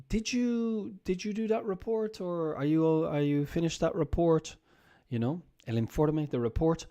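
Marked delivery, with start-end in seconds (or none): 0.1-4.6 high, light offhand question; a boss checking in, all casual; boss asking casually. Retold or imitated. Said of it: "she'll say".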